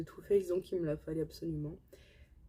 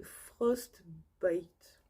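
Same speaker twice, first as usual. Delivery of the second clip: French-accented English. Real, not acted. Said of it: "Désolée pour mon anglais".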